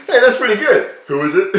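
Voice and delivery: high, loud, laughing surprise. Real, not acted. Laughing at his old impression, loud and high. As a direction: crack up at how good it is.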